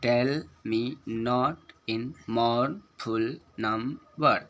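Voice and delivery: "Tell me not in mournful number" very slow, syllable by syllable; rhythmic verse reading. Shown as a demonstration. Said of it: "जैसे इसको पढ़ेंगे". ("Tell me not in mournful number" को लय में, रुक-रुककर)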